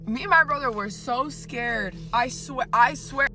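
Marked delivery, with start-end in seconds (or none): none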